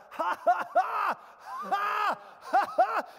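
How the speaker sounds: loud, high mock laughter in bursts; forced fake laugh. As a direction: laugh defiantly, on purpose. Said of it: "So I'd begin to laugh".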